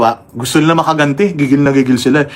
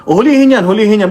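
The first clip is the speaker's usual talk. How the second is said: higher-pitched imitation of an indignant official; raised-pitch mock command; mock arrest order; 6 semitones higher than usual. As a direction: mimic someone demanding an arrest. Mimics official demanding hecklers' arrest.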